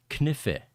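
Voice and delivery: Kniffe with the K sounded before the N; German pronunciation. Shown as a demonstration. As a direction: say Kniffe slowly in two syllables, German-style. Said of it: "the German pronunciation of knife".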